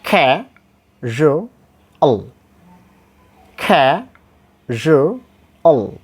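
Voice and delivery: KA, JU, AL as three separate sounds with pauses; syllable by syllable. Shown as a demonstration. Teacher spells out the sounds, syllable by syllable. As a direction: break "casual" into ka, zhu, ul. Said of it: "primary stress is given in the first syllable".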